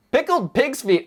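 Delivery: high, loud burst of disbelief; excited exclamation. Real, not acted. Streamer reacting to a chat suggestion, loud and excited. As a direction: blurt it out, amazed and amused.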